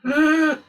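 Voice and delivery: sudden high sharp gasp; a shocked intake of breath. Real, not acted.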